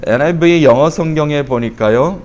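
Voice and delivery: ordinary talk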